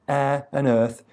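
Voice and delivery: ordinary delivery, nothing marked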